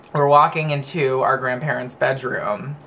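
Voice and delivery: normal talking voice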